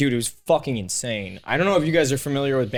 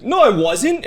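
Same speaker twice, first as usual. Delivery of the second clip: high, loud retold protest; indignant denial; 10 semitones higher, 6 dB louder than usual. Retelling his answer to the driver's blame, indignant denial. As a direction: protest the accusation in mock outrage.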